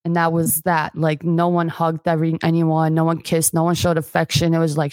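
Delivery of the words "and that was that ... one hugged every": ordinary delivery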